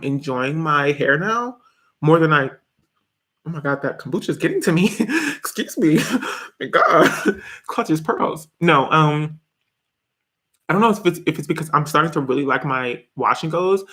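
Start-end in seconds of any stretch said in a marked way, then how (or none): none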